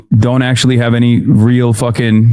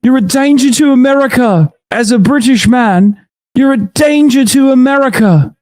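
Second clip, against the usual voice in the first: mock British accent; 11 semitones higher than usual. Streamer imitating a British person's accusations, mock British accent. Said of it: "hearing a British person go".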